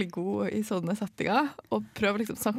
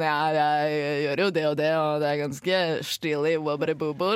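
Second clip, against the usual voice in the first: lower, slow, self-important droning; mocking the bragger; 6 semitones lower than usual. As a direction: ham up a pompous bore.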